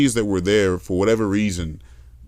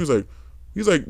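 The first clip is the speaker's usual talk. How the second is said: ordinary talk, nothing marked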